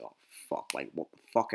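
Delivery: low, barely voiced aside; imitating kids' furtive whispers; hushed muttering. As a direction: mutter it nervously under your breath. Kids murmur after alcohol warning.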